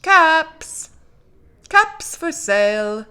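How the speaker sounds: loud, high singsong street cry; peddler's call. A narrator's reading. Peddler back in town, calling out his caps. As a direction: call out loudly, high on the price.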